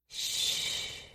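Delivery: soft unvoiced shush. A narrator's reading. Best friend shushing her crying friend, whispered. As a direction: hush her gently, breath only. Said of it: "She shushed me".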